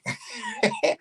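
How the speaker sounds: short high-pitched laugh. Real, not acted. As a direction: let out a brief, high laugh. Laughs at own story.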